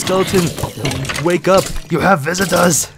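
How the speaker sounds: high, loud summoning voice; Herobrine. Acted. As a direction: call out loudly to rouse a sleeper. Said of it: "Herobrine says".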